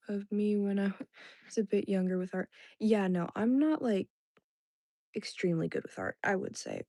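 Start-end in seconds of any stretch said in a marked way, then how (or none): none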